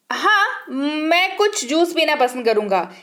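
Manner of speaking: ordinary talk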